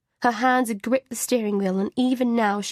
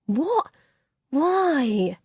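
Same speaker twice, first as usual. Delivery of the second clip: high, groaning protest; 5 semitones higher than usual. Acted. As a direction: moan it out in dismay. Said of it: "he groans".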